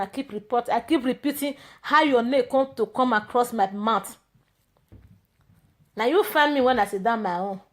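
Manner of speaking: ordinary delivery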